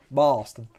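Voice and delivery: Bahston with a broad Boston accent. Shown as a demonstration. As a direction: say Boston like a Boston local. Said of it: "such a bad Boston accent".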